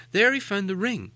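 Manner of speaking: plain reading voice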